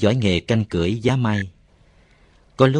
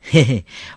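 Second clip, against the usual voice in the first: snort of laughter; 4 semitones higher than usual. Acted. Narrator laughs at boy.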